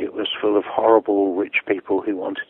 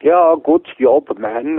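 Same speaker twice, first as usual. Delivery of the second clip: mock niceties; 7 semitones higher, 3 dB louder, much slower than usual. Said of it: "people are doing the niceties".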